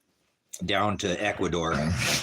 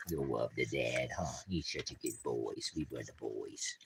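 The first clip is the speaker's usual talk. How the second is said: soft cooing; 10 semitones lower, 13 dB quieter than usual. Real, not acted. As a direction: coo quietly to the dogs. Cooing to dogs.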